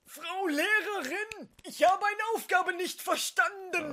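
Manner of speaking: put-on high, slow whiny kid voice; high childish voice. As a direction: play a whiny little schoolboy.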